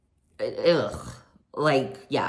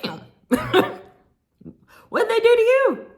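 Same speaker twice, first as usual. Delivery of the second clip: very high squealing surprise; high shocked squeal with laughter; shocked reaction; 5 dB louder than usual. Real, not acted. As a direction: shriek in disbelief. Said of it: "host reacts to TJ".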